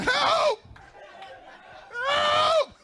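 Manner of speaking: high squealed cry of help; cartoonish high-pitched help scream; mock yell for help. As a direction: shriek it way up high like a panicked shirt. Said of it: "And he be yelling".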